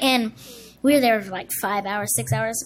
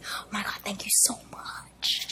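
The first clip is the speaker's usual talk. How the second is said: acted overwhelmed whisper; 3 semitones lower, 13 dB quieter than usual. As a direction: whisper it in stunned gratitude. Shaking director's hand, overwhelmed.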